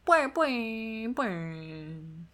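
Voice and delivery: springy boing noises; bouncy springy boingy voice. Pretend. Boing sounds.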